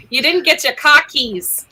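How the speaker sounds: put-on New England accent, cockies with an open broad o and no r. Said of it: "I blame my speech therapist for lack of accent".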